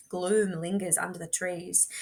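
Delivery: ordinary delivery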